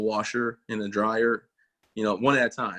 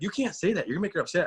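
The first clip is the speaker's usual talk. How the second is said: higher-pitched imitation of a scolding wife; objecting wife; 8 semitones higher, faster than usual. Wife telling off her husband for upsetting a visitor, high-pitched protest.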